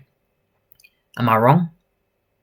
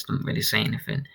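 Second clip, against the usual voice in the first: ordinary delivery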